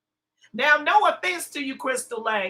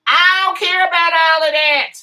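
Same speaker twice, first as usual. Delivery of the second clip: loud, higher-pitched brush-off; loud dismissal; 5 semitones higher, 10 dB louder than usual. Real, not acted. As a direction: shut the commenter down loudly. Rebuking a chat commenter.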